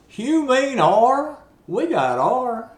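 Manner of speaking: a gas-station attendant in thick Appalachian, slow and high; Appalachian accent. Retold or imitated.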